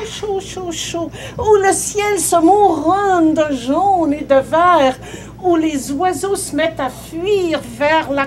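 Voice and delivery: dramatic storytelling. Acted. Teller warning of the sirens' weather, dramatically building. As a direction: declaim it with mounting intensity.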